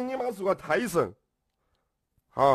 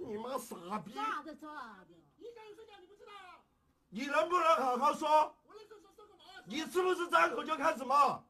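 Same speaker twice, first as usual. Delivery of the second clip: distant furious shouting; 5 semitones higher, 11 dB quieter than usual. Real, not acted. Quarrel continues away from mic.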